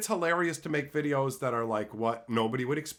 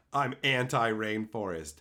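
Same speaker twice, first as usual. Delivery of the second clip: usual speaking voice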